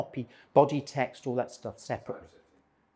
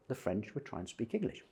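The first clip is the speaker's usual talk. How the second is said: ordinary delivery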